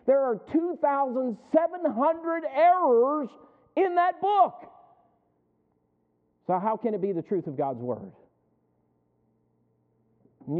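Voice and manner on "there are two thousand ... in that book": emphatic outcry. Real, not acted. Preacher hammers a point home.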